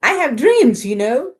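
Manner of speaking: high, loud dramatic plea; pigeon pleading. Acted. Pigeon begging the class with big dreams. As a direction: plead grandly about dreams.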